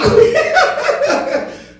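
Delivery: loud high burst of laughter. Real, not acted. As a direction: crack up at the joke. Laughs at own joke.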